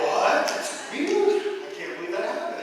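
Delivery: high, loud fake astonishment; hammed-up disbelief at the driver; mock surprise. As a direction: play innocent and shocked. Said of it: "And you go".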